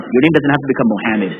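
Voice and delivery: ordinary delivery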